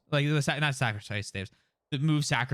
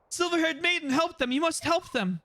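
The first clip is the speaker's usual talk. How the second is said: high-pitched urgent begging; desperate plea; 13 semitones higher than usual. Acted. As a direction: plead for help in a high, panicked character voice. Character pleads for urgent help.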